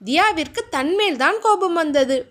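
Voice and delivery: ordinary delivery, nothing marked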